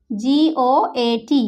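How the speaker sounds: জিওএটি spelled out letter by letter, very slowly; slow spelling. Shown as a demonstration. Teacher to students spelling জিওএটি, very slow. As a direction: dictate জিওএটি slowly to the class. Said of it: "দেখো".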